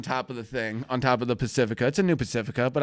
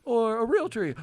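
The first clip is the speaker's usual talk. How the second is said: put-on high kid voice; 9 semitones higher than usual. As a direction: ask like an eager boy. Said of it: "my son goes".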